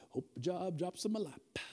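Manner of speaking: a hint of lazy wishfulness; idle job seeker. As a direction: toss it off like someone passively hoping. Mimics idle job seeker in room.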